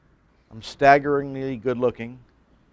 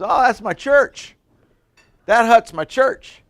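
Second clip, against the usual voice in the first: higher, slow, proud islander voice; islander in a joke; 9 semitones higher, 6 dB louder, slower than usual. Acted. Castaway proudly tells visitor about his hut church. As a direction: show off your little church slowly.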